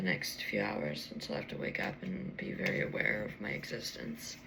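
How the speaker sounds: soft sleepy whisper. Real, not acted. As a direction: breathe the words out.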